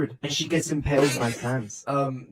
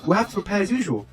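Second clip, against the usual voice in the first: lightly voiced Papyrus; 6 semitones higher than usual.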